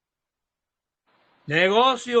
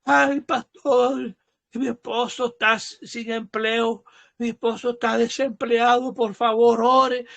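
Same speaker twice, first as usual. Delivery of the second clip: imitated tearful plea; 2 semitones higher than usual. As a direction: act out a churchgoer pleading tearfully to the pastor. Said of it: "llorando, literalmente llorándome".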